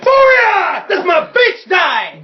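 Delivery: high, drawn-out real cheer; triumphant shout. Real, not acted. Player celebrates the die roll, shouting triumphantly. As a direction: whoop and crow over a lucky roll.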